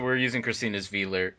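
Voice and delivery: normal talking voice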